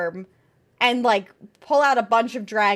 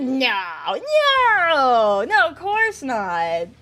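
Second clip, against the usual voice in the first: mock innocent denial; 4 dB louder, much slower than usual. Denying, slow drawn-out no.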